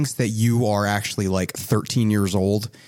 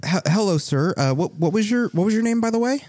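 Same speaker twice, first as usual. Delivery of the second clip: high-pitched, hesitant librarian; startled librarian; 11 semitones higher, faster than usual. Acted. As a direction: stammer a polite question in a high voice.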